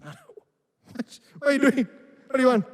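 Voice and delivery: high and loud, startled and irritated; startled annoyance. Retold or imitated. Woken at dawn by Clarence, answering with startled, irritated loudness. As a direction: snap awake and bark at him.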